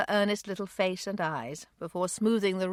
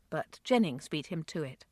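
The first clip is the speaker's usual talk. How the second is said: ordinary delivery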